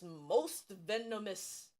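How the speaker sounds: ordinary delivery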